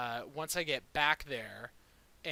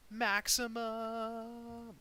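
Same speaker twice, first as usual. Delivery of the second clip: drawn-out cheer; 9 semitones higher, much slower than usual. Real, not acted. Celebrating a max roll.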